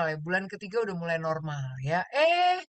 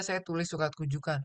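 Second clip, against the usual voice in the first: usual speaking voice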